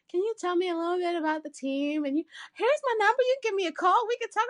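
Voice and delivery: fast, high, eager request; quick eager ask. Retold or imitated. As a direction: rattle it off, high and keen. Retelling her own quick message.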